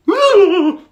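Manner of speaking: loud squeaky nervous laugh; high ladybird giggle. Acted. Mister Ladybird meeting the beetles, squeaky laugh. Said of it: "said Mister Ladybird".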